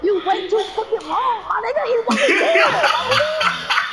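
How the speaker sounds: high and loud, laughing through the words; laughing taunt. Real, not acted. Gamer teasing a teammate, laughing loudly. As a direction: crack up while ribbing him.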